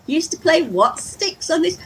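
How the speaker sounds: high, loud astonished question; mock incredulity. Viewer querying the odd game name, mock incredulous. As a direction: play a baffled viewer who can't believe it.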